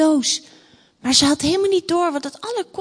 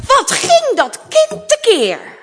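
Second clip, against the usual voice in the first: high, loud, slow storyteller outcry; exasperated exclamation; 11 semitones higher, 8 dB louder than usual. A narrator's reading. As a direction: draw it out in loud dismay. Narrator exclaims at princess's fuss. (luide, verontwaardigde uitroep)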